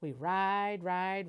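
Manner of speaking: usual speaking voice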